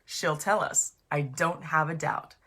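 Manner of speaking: plain reading voice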